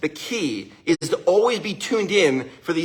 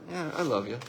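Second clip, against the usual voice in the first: much softer, lower, mumbled; halfhearted; 3 semitones lower, 10 dB quieter than usual. Shown as a demonstration. Dad answering his daughter, softly and dismissively. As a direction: toss off "I love you" without feeling. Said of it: "imagine if I said to her".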